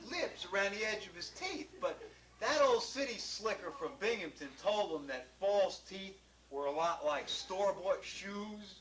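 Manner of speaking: plain reading voice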